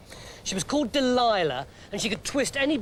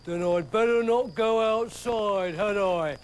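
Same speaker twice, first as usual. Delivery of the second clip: lightly voiced Samson, knowing; a hint of a slow giant; Samson, big lumbering hero; 3 semitones higher, 3 dB louder than usual. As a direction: play the strongman, a touch smug.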